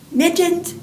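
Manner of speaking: put-on a boy calling his lost mittens, high and loud. A narrator's reading. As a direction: sing out the name to find them.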